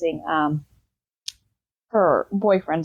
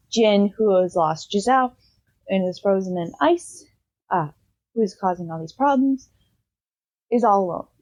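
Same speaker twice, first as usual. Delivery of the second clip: ordinary talk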